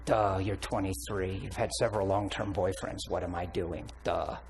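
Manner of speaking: low, soft, mock-obvious "duh" voice; dopey inner thought. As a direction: think it aloud, low and dumb. His dim inner answer to her.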